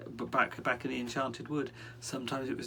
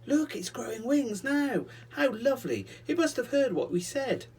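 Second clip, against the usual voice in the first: delighted cry; 13 semitones higher, 6 dB louder than usual. Acted. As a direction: gasp out the joy of a little girl. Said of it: "Molly cried out in delight".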